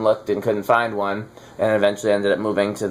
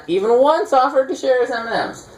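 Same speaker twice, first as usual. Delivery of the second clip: high, whiny mock complaint; mock indignant; 12 semitones higher than usual. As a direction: sound theatrically wronged. Complaint repeated, much higher.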